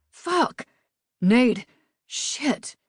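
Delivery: sharp high cry of pain, then a breathless curse; sudden pained swearing. A narrator's reading. Rob hit by a bullet, calling to Nate in pain. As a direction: shot and shocked, crying out. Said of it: "grabbed his upper left abdomen and swore".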